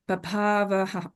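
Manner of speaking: usual speaking voice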